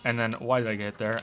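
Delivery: normal talking voice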